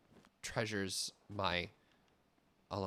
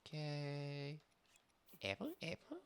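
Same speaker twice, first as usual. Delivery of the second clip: game character voice; 5 semitones higher, 13 dB quieter, slower than usual. Pretend.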